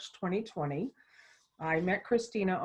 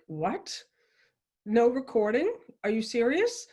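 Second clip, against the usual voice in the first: higher, louder disbelief on No recording; stunned wife reacting to husband's news; retold shock; 4 semitones higher, 3 dB louder than usual. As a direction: replay the jolt of bad news. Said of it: "this moment of shock".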